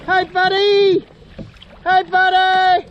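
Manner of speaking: high, loud, slow friendly call. Real, not acted. Kayaker hails the surfacing whale, loud friendly call. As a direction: stretch the greeting out over the water.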